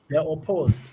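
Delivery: normal talking voice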